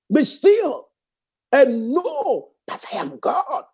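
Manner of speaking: high, slow proclaiming; preacher proclaiming scripture; preaching. Acted. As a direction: declare it slowly up high. Emphatic scripture declaration.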